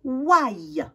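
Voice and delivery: WHY said as French wa-y, drawn out; WHY as a long demonstrated glide; OY sound. Shown as a demonstration. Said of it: "ça va faire".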